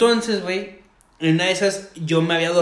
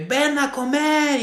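slow, high mock mom calling to dinner; 9 semitones higher, much slower than usual. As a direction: drawl it out like a mother calling the kids. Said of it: "y mamás así". (mamá llamando a comer, lento y agudo)

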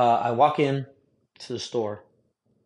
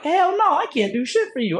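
mock outraged refusal; 14 semitones higher than usual. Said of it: "I'm like".

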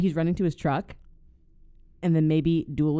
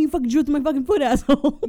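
much higher, much louder scream, half laughing; furious yell; 10 semitones higher, 7 dB louder, faster than usual. Pretend. Robber with his drilled foot screaming at the plumber, loud mock rage.

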